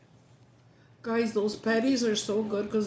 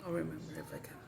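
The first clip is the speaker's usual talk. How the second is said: whispered aside; 3 semitones lower, 11 dB quieter, faster than usual. Real, not acted. Trying to recall the show's name, a whispered aside to herself. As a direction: whisper it to yourself, unvoiced.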